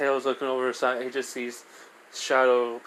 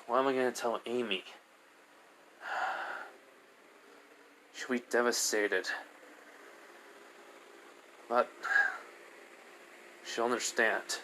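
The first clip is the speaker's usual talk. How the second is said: soft, downcast musing to oneself; quietly troubled; 10 dB quieter than usual. Acted. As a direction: sigh and worry softly, with long pauses. Shadow worries about telling Amy.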